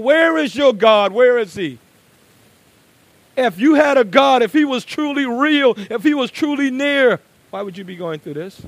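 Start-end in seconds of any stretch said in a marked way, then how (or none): 0.0-1.8 loud, higher, scornful jeer; mocking taunt. Preacher voicing the enemy, loud and mocking. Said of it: "The enemy mocks and ridicules". 3.4-7.2 loud, high, ringing pulpit delivery; preaching at full force. Acted. Preacher voicing the psalmist's plea, loud and ringing.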